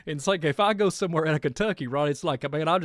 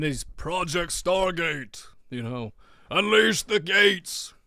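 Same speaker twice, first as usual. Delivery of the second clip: ominous; 3 semitones higher, 4 dB louder, much slower than usual. Acted. Dramatic ominous aside, slowed, louder.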